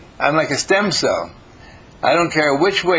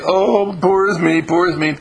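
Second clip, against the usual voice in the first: self-pity; 6 semitones higher, 4 dB louder than usual. Pretend. Self-pitying whine acted out.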